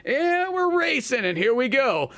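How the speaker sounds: high, drawn-out launch call; excited race start. Retold or imitated. Two friends starting Mario Kart through the wall, excited race start. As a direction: kick it off like a starting announcer.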